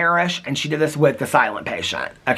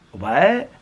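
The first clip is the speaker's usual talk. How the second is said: rising, puzzled "what?"; retold disbelief, voice sweeping up; baffled question; 2 semitones higher than usual. Friend hears an absurd Eiffel Tower claim, baffled.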